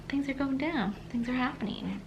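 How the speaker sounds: ordinary talk, nothing marked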